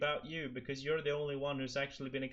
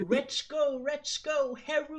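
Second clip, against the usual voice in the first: cheering dad; 6 dB louder than usual. Retold or imitated. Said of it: "and go like".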